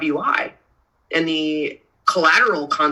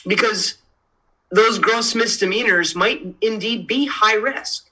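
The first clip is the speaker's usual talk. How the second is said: ordinary talk, nothing marked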